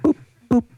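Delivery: high little boops; short, high playful pops; poking noise. A narrator's reading. Farmer poking with her little finger, high boops.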